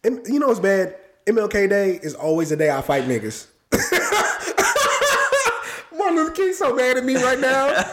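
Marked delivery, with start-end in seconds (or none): 3.7-7.8 high laugh spilling into the words. Real, not acted. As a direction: crack up as you say it. Laughing at own joke.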